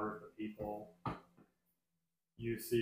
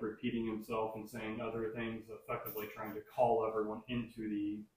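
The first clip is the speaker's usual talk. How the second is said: ordinary talk, nothing marked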